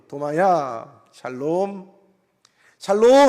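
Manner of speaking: Jesus calls out peace straight to Thomas; Jesus calling out. Retold or imitated.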